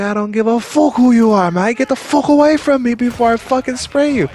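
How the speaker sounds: high, loud, furious warning; re-enacted angry shout. Guard warning off the celebrity, re-enacted angry shout. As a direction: yell a threat to back someone off.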